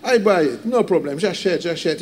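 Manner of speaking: light mock American accent, impressed; lightly imitated American accent. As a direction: gush like the American buyer in his accent. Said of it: "il dit".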